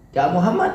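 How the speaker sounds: "ja'a Muhammad" as a question awaiting an answer; rising question intonation. Shown as a demonstration. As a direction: ask "ja'a Muhammad" with the pitch going up. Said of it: "naik dia".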